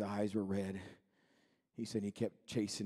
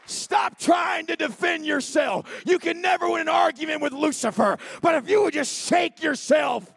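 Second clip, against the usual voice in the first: roaring, high preacher's shout; full-force preaching; 11 dB louder, slower than usual. Acted. Preacher shouting at the congregation, full force. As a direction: thunder it at the congregation.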